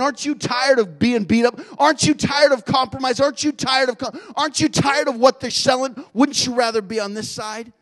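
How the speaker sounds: loud, high, pressing questions; Jehu urging the soldier to switch sides, loud and passionate; impassioned challenge. Acted. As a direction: hammer each question home.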